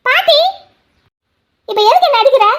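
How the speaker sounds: sudden high yelp of her name; startled cry. Acted. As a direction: cry out as the slap lands. இப்ப எதுக்கு என்ன அடிக்கிற?.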